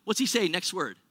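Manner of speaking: ordinary delivery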